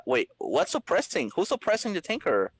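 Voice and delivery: usual speaking voice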